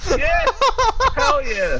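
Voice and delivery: high, loud, laughing celebration; excited cheering. Real, not acted. Teammate cheering on a kill, laughing excited shout.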